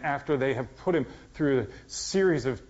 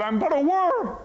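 sudden high, loud cry of despair; a desperate shout from the cross; anguished cry; 8 dB louder than usual. Retold or imitated. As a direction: cry it out in agony, a far-off voice. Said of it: "cry out".

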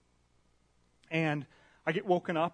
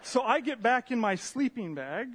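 ordinary delivery

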